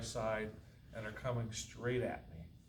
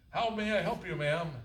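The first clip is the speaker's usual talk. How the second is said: put-on young clerk voice; 8 semitones higher, 8 dB louder than usual.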